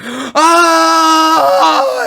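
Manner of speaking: high, loud agonised scream "Ah"; loud retold scream of pain. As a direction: scream it like you've just been hit in the nuts. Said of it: "And I yelled".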